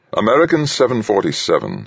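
lightly voiced hard-edged pilot; hard, cool voice. A narrator's reading. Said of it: "cool man's voice".

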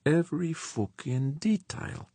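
very high, slow character voice; George, emphatic agreement. A narrator's reading. Spaceman agrees with crewmate about the saboteur, high character voice.

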